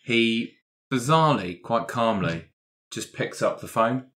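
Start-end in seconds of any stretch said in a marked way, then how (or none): none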